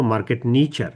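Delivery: Market নিচার with a hard ch sound, নিচার; নিচার pronounced with ch, not sh; say niche-er. Shown as a demonstration. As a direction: say নিচার as nee-char with ch. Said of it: "উচ্চারণটা কিন্তু".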